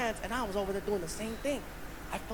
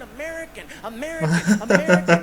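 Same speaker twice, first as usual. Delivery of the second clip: repeated; 11 dB louder than usual. Retold or imitated. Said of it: "He kept saying".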